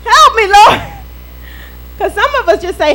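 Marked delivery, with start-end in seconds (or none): 0.0-0.8 loud, higher cry for help; mock scream for help. Preacher acts out believers crying to the Lord, mock scream. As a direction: holler out a hammed-up plea. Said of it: "would scream out the words".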